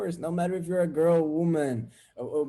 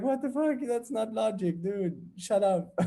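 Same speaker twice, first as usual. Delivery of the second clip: higher-pitched, mildly indignant protest; mock exasperation; 6 semitones higher than usual. Retorting to bad logic, acted exasperation. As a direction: act out baffled annoyance.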